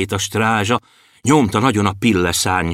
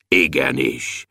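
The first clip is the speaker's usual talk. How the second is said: deep, low dwarf voice; thick voice; 10 semitones lower, slower than usual. A narrator's reading. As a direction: answer low and thick like a big bell. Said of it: "mondta Bobolysza vastagon". (vastag, mély törpehang)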